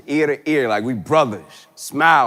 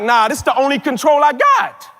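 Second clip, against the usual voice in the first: defiant shout; 8 semitones higher than usual. Acted. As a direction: shout it like you won't let go. Stubborn refusal, acted quote.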